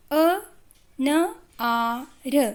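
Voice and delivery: syllable by syllable, drawn out; slow sounding out. Shown as a demonstration. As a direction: stretch each sound apart, very slowly. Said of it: "sounding out syllables slowly".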